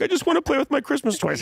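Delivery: put-on high, fast whining child voice; whiny kid. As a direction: whine like a kid who wants to get back to his toys. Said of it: "we'd be like".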